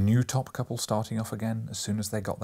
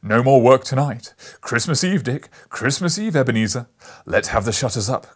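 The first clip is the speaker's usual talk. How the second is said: jolly Fezziwig shout; 10 dB louder than usual. A narrator's reading. Fezziwig calls his boys to stop work, loud and hearty. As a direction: boom it like a merry old master. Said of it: "cried old Fezziwig".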